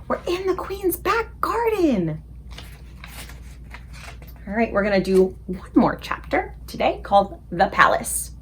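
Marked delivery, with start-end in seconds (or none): 0.1-2.2 excited whisper. A narrator's reading. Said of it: "Sophie whispered excitedly".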